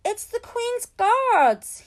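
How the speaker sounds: high, loud, drawn-out cry of delight; a boy crying out in wonder at the guards; excited cry. A narrator's reading. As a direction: shout it out, thrilled to see them. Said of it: "he cried out".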